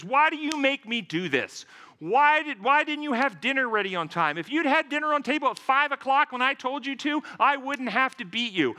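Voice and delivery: mock-furious demand from a hitting husband; abusive husband. Counselor voicing a husband beating his wife, angry.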